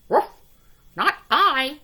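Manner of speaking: high yappy barks then a loud refusal; a little dog saying no. A narrator's reading. Dog refusing the hen, loud yappy. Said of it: "said the dog".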